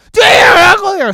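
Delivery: loud mock echo. Shown as a demonstration. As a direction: play the echo bouncing back loud.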